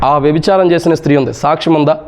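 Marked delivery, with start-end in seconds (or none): none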